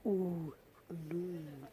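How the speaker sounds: ooh in noon stretched long; dragged ooh in noon. Shown as a demonstration. Teacher drawing out the vowel of noon, stretched ooh. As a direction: hold the ooh sound in noon. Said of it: "drag the two O's".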